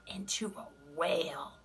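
hushed storyteller whisper. A narrator's reading. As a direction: breathe the name for suspense. Suspense before new animal.

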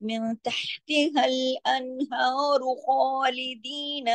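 slow recital running अनहार into खालिदीन with a linking u; joined recitation. Shown as a demonstration. Teacher shows reading the words joined, slow connected recitation. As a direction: recite slowly, linking without a stop. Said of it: "मिला के पढ़ेंगे".

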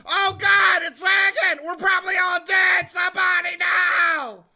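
high frantic yelling, game lagging; panicked shouting. Real, not acted. Game lagging during attack.

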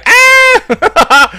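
high, loud cartoon shriek; exaggerated yell; mock falling scream. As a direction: imitate a scream while falling, breaking into laughter. Mimics the falling woman's scream.

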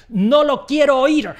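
high, loud refusal; mock outrage. Teacher rejecting the lazy version, outraged shout. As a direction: cry out in mock horror.

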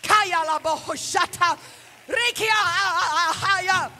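speaking in tongues. Retold or imitated. Preacher reenacting speaking in tongues at the altar. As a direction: let the tongues flow slowly. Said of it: "The Holy Ghost was talking through me".

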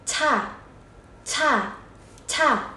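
"cha" opened with a strong puff of air; aspirated c. Shown as a demonstration. Teacher drilling pinyin c, "cha" said with aspiration. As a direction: burst the air out, then a high falling "cha". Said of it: "bật hơi, đọc bằng âm gió".

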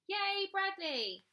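high, drawn-out cheer. A narrator's reading. Toy animals thanking Bradley for food, cheering. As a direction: cheer him on with a long "yay". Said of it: "They all cheered".

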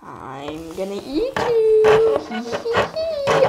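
slow, spooky monster threat with a high cackle; mock monster growl ending in a high giggle; table monster. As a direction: play the monster hungry, then cackle. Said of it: "I'm the table monster".

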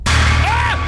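high, loud scream of fright; startled scream. Real, not acted. Player hit by a scare, high startled scream. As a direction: scream as if jumped at.

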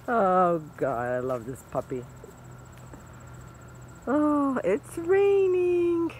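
ordinary talk, nothing marked

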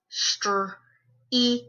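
str, then "eat" in separate sounds; sounded out. Shown as a demonstration. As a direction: break str, eat apart with pauses. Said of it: "We're gonna sound them out".

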